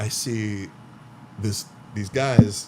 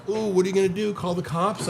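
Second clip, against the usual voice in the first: higher, teasing ooh; sing-song mock taunt; 7 semitones higher than usual. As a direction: goad him like a bully. Taunting the man in his house.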